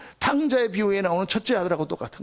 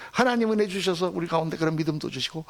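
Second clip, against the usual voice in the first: usual speaking voice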